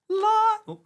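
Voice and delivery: high, bright, loud "light!"; playful exclamation. Pretend. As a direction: cheer for a toddler. Playful light game with toddler.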